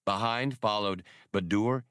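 ordinary delivery

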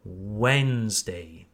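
slow, low Wednesday as "WENZ-day"; Wednesday in two falling syllables; silent D and E. Shown as a demonstration. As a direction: drop the d and following e in Wednesday. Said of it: "are silent. We don't pronounce them".

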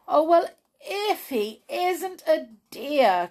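high, loud, slow gush of delight; delighted cry. Acted. Josie hears Jolly's letter, crying out in delight. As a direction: Josie melts at Jolly's kindness. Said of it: "cried Josie".

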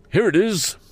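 high, pompous Mr Wopsle voice; fussy pompous man. A narrator's reading. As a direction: raise the pitch, puff it up.

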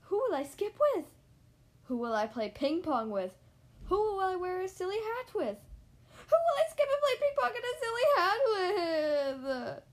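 loud lament building to a high wail; hammed-up tearful outburst; tearful pleading. A narrator's reading. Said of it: "tears welling up in his eyes".

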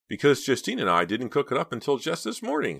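high, amused, mock-giggly explanation; still laughing. A narrator's reading. Les confessing the prank to friends, still laughing. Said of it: "said Les, still laughing".

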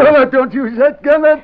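put-on old man begging the hero not to gas him; frightened plea. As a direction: plead for mercy. Old man pleads not to be gassed.

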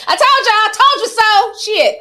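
high, loud i-told-you-so; triumphant told-you-so; gloating. Real, not acted. Gloating at fanboys who doubted her.

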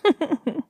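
put-on a short, high girlish giggle; high playful giggle. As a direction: giggle at him, delighted. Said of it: "She giggled".

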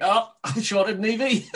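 mock realization breaking into a laugh. Trader discovering the mistake after shorting, laughing.

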